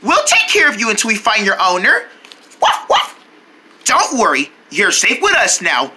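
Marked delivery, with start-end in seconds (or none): 2.6-3.2 high, short puppy barks; two quick high woofs; dog barking. Acted. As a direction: yap like a little dog.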